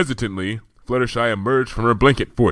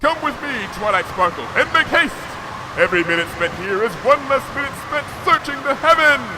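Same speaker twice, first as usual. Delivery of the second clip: high, loud royal urgency; a princess hurrying her companion; Luna's commanding voice; 4 dB louder than usual. A narrator's reading. Luna to Twilight, commanding haste.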